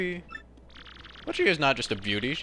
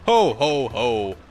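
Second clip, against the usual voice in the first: big belly laugh, falling low; punchy, deep-dropping festive chuckle; Santa Claus; 3 semitones lower, 5 dB louder, much slower than usual. Pretend. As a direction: boom out a mock jolly ho-ho. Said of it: "we are going to become Santa Claus".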